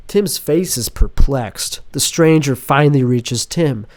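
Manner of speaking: normal talking voice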